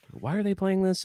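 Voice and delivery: high, puzzled "why are they playing this"; baffled inner question. Fan at the concert, puzzled by the song choice. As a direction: wonder aloud in disbelief.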